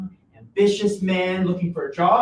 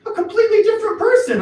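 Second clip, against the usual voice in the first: high, cracking plea; voice cracking with exasperation; 7 dB louder, faster than usual. Acted. Joe pleading with his changed son, voice breaking high. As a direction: let the voice break in frustration.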